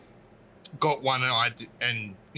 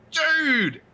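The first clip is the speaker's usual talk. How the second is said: excited call out; 5 dB louder than usual. Retold or imitated. Calling friend with big news.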